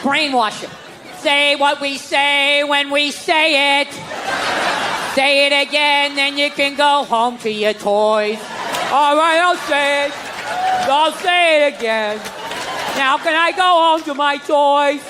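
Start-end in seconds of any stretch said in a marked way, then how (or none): 1.2-8.4 put-on slow, sing-song drilling of a child; mock Sunday school teacher. 8.9-15.0 put-on slow, high sulky child voice; mock whiny kid. Kid repeating under duress.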